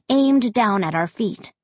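ordinary delivery, nothing marked

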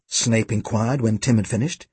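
usual speaking voice

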